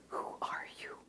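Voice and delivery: a clerk whispering across the counter. Retold or imitated. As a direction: lean in and whisper the question. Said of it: "And he's still whispering".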